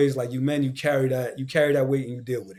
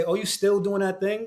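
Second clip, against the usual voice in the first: higher-pitched imitation of a doubter; mock doubting; 6 semitones higher than usual. Mimicking people who question his creative work, mock doubting. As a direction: put on a higher, belittling question.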